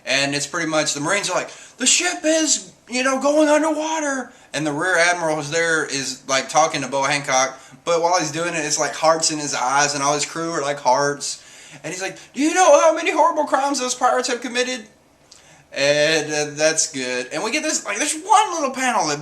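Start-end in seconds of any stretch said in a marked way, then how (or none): 1.8-4.3 high-pitched put-on outcry; mock alarmed Marines. Marines seeing the ship go underwater, mock high-pitched alarm. 12.3-14.9 high, loud put-on indignation; mock indignant admiral. Rear admiral talking to Boa Hancock, hammed-up indignant outburst.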